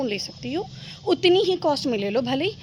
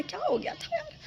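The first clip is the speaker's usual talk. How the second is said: mock indignant whine; 7 dB quieter than usual. Complaining nobody gave birthday gift.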